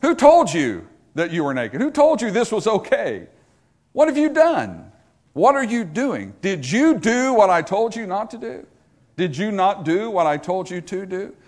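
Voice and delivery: high, forceful volley of questions; God interrogating. Acted. Preacher as God confronting a sinner, high and insistent. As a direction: preach it as God demanding answers. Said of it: "God shows up on the scene sometimes and says".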